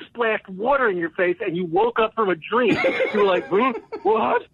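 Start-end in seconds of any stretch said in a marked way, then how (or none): 3.5-4.5 high startled what, like waking up; dazed surprise. Retold or imitated. Retelling his friend bombing on stage, dazed and startled. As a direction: snap out of a dream, confused.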